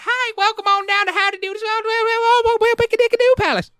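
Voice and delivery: very high, cartoon old-timer voice; high-pitched backwoods welcome, hammed up; prospector from Gravity Falls. Shown as a demonstration. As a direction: squeal it like a manic hillbilly prospector. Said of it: "that was the prospector from Gravity Falls".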